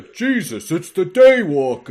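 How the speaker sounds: high, loud, slow mock shout; a terrified thug crying out the name; panicked henchman yell. Vampire henchman spotting Blade, frightened shout. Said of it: "you hear a guy off screen go".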